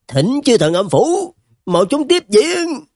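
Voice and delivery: high, drawn-out hoarse cry; hoarse roar. Acted. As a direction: bellow for reinforcements, stretching each word. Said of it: "cái giọng khàn đặc, gào lớn".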